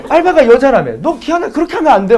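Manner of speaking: loud, high-pitched imitation of a telling-off; angry mother. Mother telling son off over a female part-timer, angry. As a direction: lay into your son like an outraged mom. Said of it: "엄마가 막 존나 화를 내".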